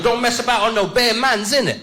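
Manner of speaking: high, swaggering street slang; mock London roadman; roadman kid. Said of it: "He goes".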